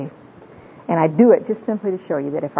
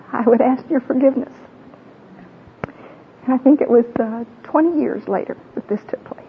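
higher pitched, fighting back real tears; teary voice; 7 semitones higher than usual. Real, not acted. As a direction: voice rising high as tears come. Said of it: "my voice goes way up like this".

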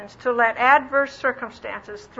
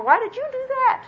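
much higher, shrill-free squeaky mock accusation; high-pitched accusation; 13 semitones higher, 3 dB louder than usual. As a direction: pitch it way up like a nagging voice.

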